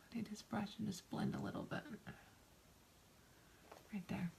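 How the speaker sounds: soft unvoiced whisper while blending. Real, not acted. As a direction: whisper it to yourself as you work. Quiet narration while blending makeup.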